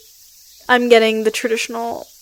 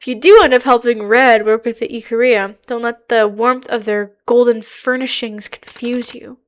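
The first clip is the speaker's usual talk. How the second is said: ordinary talk, nothing marked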